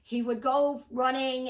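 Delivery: ordinary talk, nothing marked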